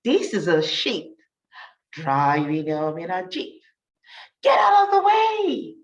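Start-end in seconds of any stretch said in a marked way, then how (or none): 4.4-5.8 high, loud yell. Acted. Sheep yelling from the jeep, high and loud. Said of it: "he yells with a beep".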